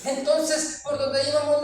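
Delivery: usual speaking voice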